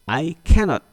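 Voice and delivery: "cannot" punched loud and high; "cannot" said loud and high to show the negative; stress on cannot. Shown as a demonstration. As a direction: hit "cannot" hard. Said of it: "Apabila ada kata-kata not".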